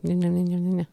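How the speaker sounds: rapid-fire mock gabble; mocking fast talk. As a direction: imitate someone rushing their words. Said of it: "you did it pretty fast".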